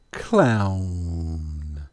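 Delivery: clown with a very long "ow"; low, drawn-out falling clown; demonstrated "ow" sound. Shown as a demonstration. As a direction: stretch clown out, sinking low. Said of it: "which gives you the pronunciation".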